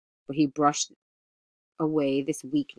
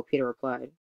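ordinary delivery, nothing marked

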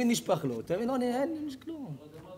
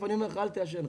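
soft, restrained fatherly request; sincere plea; 5 dB quieter, faster than usual. Shown as a demonstration. Smoking father asking his son not to smoke, softly sincere. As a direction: quietly ask it as someone who suffers from it. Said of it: "לא, לא ככה".

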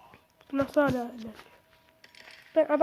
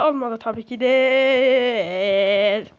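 slow, drawn-out resigned sarcasm; dragged-out ironic groan; sarcastic drawl; 4 semitones lower, 4 dB louder, much slower than usual. Real, not acted. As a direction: sigh it out, of course it failed. Sarcastic defeat after failing.

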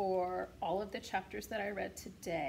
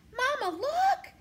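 very high, loud gosling squeal; excited squeak; 9 dB louder, slower than usual. A narrator's reading. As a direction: squeal it out like an excited baby bird. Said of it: "he squeaked".

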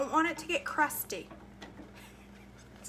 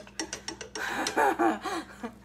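rapid chuckling laugh; 4 semitones higher than usual. Real, not acted. Cook joking about beating the porridge, laughing. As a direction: laugh at your own joke. Laughs at her own joke.

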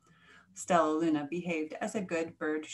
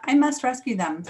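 loud, higher, urgent exclamation; emphatic heroic alarm; urgent resolve; 5 semitones higher, 9 dB louder than usual. A narrator's reading. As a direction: declare it urgently, racing to help. Stella Luna rushes to save friends.

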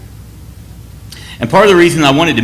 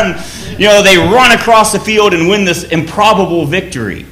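usual speaking voice

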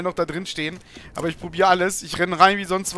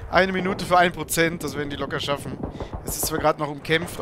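ordinary talk

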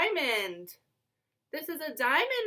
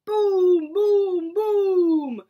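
loud, ringing booms; imitated thunderclaps; thunder booms; 3 semitones higher, 7 dB louder, much slower than usual. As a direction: boom like thunder, each word falling away. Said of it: "Mister Brown makes thunder".

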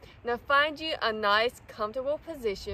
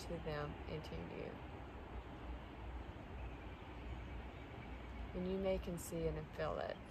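soft, low meditation voice; guided meditation; 5 semitones lower, 16 dB quieter than usual. Real, not acted. Describing the energy cord to listeners, soft meditation voice. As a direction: talk them through it softly, with long pauses.

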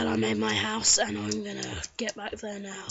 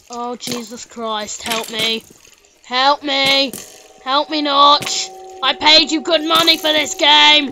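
loud, high, desperate pleas; panicked pleading; 4 semitones higher, 13 dB louder, slower than usual. Pretend. Gamer about to die, pleading with Notch in loud panic. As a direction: beg for rescue in mock panic.

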